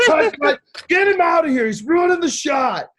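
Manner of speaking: high, furious retold shout; angry director. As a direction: yell like a director halting the shoot. Director angrily stops the shoot.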